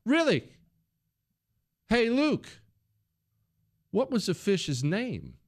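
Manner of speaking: high, drawn-out doubting question; skeptical challenge. Retold or imitated. Father testing his son about the fish, high and slow. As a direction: call his bluff slowly. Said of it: "and I said".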